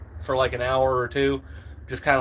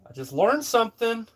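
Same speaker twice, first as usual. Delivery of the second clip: pitched up high, pleased with himself; higher repeat; 8 semitones higher than usual. Shown as a demonstration. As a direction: say it again much higher, proudly. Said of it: "Let's see if I can repeat it".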